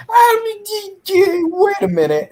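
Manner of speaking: high, stammering protest; high stammering alien lady. Retold or imitated. Green lady stammers at Alan, high and flustered. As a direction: stutter it out in a high voice. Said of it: "the green lady is like".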